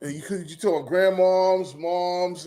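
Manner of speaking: ordinary talk, nothing marked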